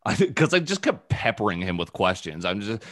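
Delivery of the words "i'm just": ordinary talk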